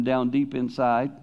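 usual speaking voice

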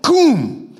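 high, sharp command; emphatic command. Acted. Jesus emphatically ordering the dead girl, high and forceful. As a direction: snap the girl awake with authority. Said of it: "He said it emphatically".

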